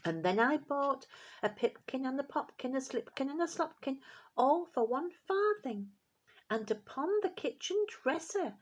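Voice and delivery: high, cheeky mouse voices teasing; rude little mice. A narrator's reading. Rude mice tease the cat through the window, high and cheeky. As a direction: taunt the cat like saucy mice. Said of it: "added the rude little mice".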